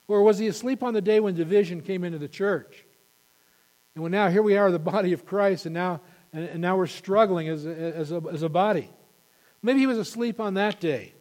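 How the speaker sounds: loud, high, rising preacher's fervour; preaching at full force. Real, not acted. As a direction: drive it home to the congregation.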